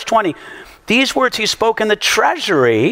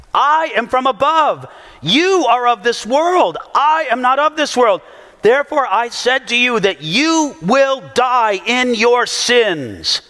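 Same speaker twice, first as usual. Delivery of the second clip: scripture reading; 4 semitones higher than usual. Acted. Preacher reading Jesus' words to the congregation, solemn and raised. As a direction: proclaim the words from the pulpit.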